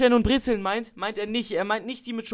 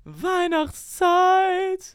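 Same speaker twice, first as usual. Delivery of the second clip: stretched jingle; 7 semitones higher, much slower than usual. Shown as a demonstration. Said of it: "mit deiner dunkelsten Stimme".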